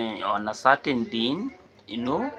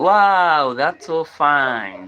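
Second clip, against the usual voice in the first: excited wow; 5 semitones higher, 10 dB louder, much slower than usual. Real, not acted. Host reacts to a viewer's comment.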